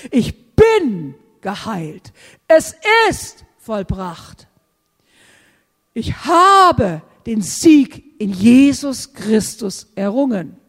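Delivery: slow, loud proclamation of victory; slow triumphant faith confession; bold declaration. Shown as a demonstration. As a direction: stand on the promise and declare it. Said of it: "du stehst auf der Verheißung".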